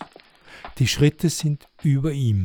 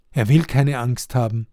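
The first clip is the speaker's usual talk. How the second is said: usual speaking voice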